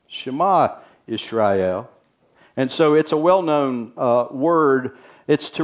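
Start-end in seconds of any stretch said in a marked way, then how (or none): 0.1-1.9 "Shema Israel" intoned low and slow; low, slow reverent "Shema Israel"; "Shema Israel" spoken slowly like a prayer; solemn Hebrew. Shown as a demonstration. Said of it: "It's called the Shema".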